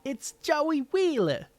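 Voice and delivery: very high, loud, slow mobster-style cartoon voice; Joey Wheeler. Streamer introduces the character as godfather of games, high cartoon voice. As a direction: announce yourself big and high, vowels stretched. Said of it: "my Joey Wheeler voice is a lot higher".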